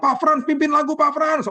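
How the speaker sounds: high eager call to Pak Frans; calling to the song leader. Retold or imitated. Congregation calling Pak Frans to lead a song, high eager call.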